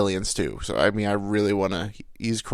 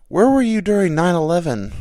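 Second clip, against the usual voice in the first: coworker voice; 9 semitones higher, 8 dB louder than usual. Retold or imitated.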